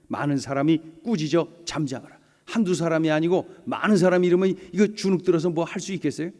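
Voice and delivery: ordinary talk, nothing marked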